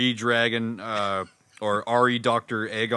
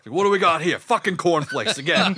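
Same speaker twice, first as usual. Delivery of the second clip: put-on grumpy New York accent, higher and louder; 6 semitones higher, 4 dB louder than usual. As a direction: grumble it like a cranky New Yorker. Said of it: "just doing the New York accent".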